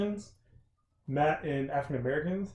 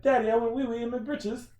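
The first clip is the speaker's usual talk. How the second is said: high mock British child voice; a little British kid confessing, high; 8 semitones higher than usual.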